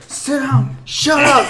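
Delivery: screechy imitation of a raging instructor; completely losing it. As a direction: bark orders in a hammed-up scream. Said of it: "completely lost it".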